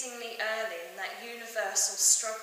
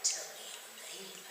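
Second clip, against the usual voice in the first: whispered menace; 10 dB quieter than usual. Acted. Convict menaces the boy.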